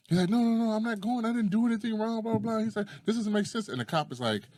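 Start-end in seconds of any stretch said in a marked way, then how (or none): 0.3-2.6 high-pitched mock pleading; mock protest, pitched well up; protesting arrestee. Acting out man resisting arrest. 3.0-3.7 high-pitched mock complaint; protesting arrestee. Acting out man resisting arrest.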